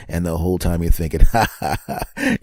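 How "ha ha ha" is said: gloating laugh. Acted. Gloating at wife, invisible.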